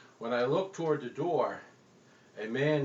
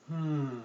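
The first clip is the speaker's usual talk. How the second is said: savoring hmm. Acted. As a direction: hum like biting a donut. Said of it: "this little sound".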